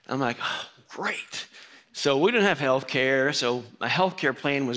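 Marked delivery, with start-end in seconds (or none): 0.4-1.5 whispered dry sarcasm; soft sarcastic aside; sarcastic oh great. Retold or imitated. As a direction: breathe it out, deadpan dismay. Sarcastic reaction to bad news.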